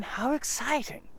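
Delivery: put-on high, bright boy voice; eager boy. Christopher Robin amazed at Eeyore's news, high and eager. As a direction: sound like a keen young boy.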